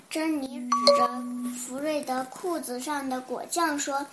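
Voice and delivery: ordinary delivery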